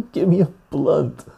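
laughing while saying Give me a blunt; chuckling through the name. Real, not acted.

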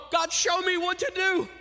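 loud, high cry to God; pleading prayer. Retold or imitated. Parent praying about their kids, loud high plea. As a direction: call out to God for help. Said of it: "And you go".

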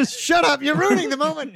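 high, loud play outrage; mock scolding. Mock scolding for interrupting the quote.

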